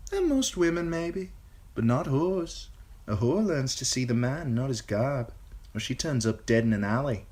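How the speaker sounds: lightly voiced young woman. A narrator's reading. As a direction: speak from hard experience.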